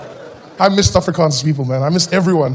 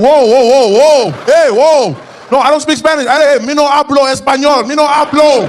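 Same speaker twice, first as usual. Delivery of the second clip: high, loud alarmed protest; alarmed high shout; panicked backing off; 9 semitones higher, 6 dB louder, slower than usual. Retold or imitated. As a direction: fend them off in broken Spanish. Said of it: "I'm like".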